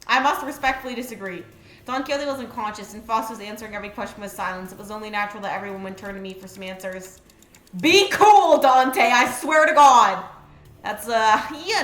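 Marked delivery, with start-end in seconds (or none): 0.1-7.2 quick, low narration with little lift; understated story reading; reading game text aloud. A narrator's reading. 7.8-10.2 loud, stretched-out exasperation; exasperated outburst. Real, not acted. Scolding a game character.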